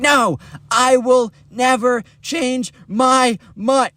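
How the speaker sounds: high, slow, word-by-word dramatic vow; hammed-up diva refusal, every word drawn out; over-the-top refusal. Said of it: "she's a little extra".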